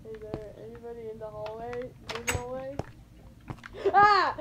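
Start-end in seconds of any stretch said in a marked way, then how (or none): 3.9-4.4 high, loud shriek; frightened scream. Real, not acted. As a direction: scream at a sudden scare. Monster scare in game.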